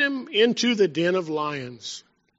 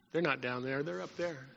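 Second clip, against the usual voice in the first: hushed inner thought; 6 semitones lower, 14 dB quieter than usual. Retold or imitated. Spafford's imagined inner thought.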